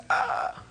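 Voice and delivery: breathy frightened ah; startled gasp. Retold or imitated. Teacher acting out Johnny startled by the dog. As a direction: gasp like a scared little boy. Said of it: "Little Johnny goes".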